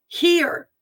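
"Hear!" pitched high, a falling exclamation; stress on Hear. Acted. Reading out a capitalized command, "Hear!" exclaimed high. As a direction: punch "Hear!" high as a one-word command. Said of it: "That's capitalized".

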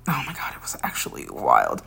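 whispered, disbelieving; awed whisper. Real, not acted. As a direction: hush it like a secret. Recalling the wild situation.

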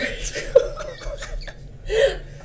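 breathy laugh at own attempt; embarrassed laugh. Real, not acted. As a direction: burst out laughing at yourself. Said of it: "sounded like you got the hiccups".

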